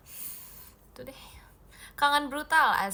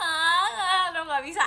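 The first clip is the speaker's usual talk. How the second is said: choked up; 6 semitones higher, 9 dB louder, slower than usual. Real, not acted. Moved by the viewer's message.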